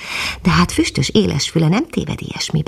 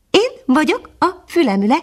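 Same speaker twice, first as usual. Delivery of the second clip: much higher, modest nightingale; modest little bird; 12 semitones higher than usual. Acted. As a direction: introduce yourself shyly as a tiny bird. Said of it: "mondta a kis madár szerényen".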